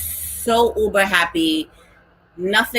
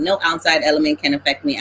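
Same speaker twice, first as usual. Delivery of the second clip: usual speaking voice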